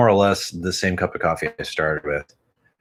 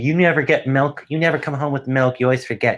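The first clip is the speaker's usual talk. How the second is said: higher, whiny nagging complaint; mock nagging; 6 semitones higher than usual. Speaker plays himself nagging about milk, whiny and high. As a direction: nag like a fed-up spouse. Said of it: "I complained".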